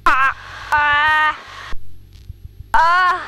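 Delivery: straining grunts under g force; under heavy g force. Real, not acted. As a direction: grunt through the pull of positive G's. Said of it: "That's hard, huh?".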